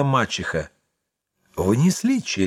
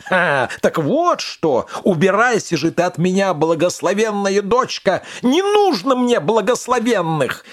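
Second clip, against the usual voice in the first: higher, building witch anger; angry witch; 7 semitones higher than usual. A narrator's reading. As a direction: drive the girl out, rising fury. Baba Yaga drives her out.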